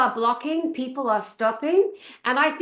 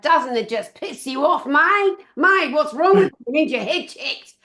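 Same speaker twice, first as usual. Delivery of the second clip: loud, pitched-up blunt confrontation; in-your-face; 4 semitones higher, 6 dB louder than usual. Retold or imitated. Blunt bloke challenges a mate, in your face. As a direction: imitate someone leaning in to challenge a mate. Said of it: "they're just so, like, in your face".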